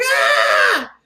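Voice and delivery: loud, high mock scream; freak-out scream. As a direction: shriek in hammed-up panic. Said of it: "she just has a freak out".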